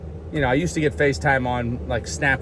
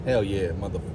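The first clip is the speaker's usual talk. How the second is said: deadpan cool guy; 8 semitones lower, 4 dB quieter than usual. Retold or imitated.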